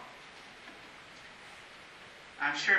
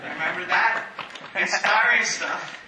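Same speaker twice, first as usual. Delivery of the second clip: laughing; 6 dB louder than usual. Real, not acted. Laughing after his own impression.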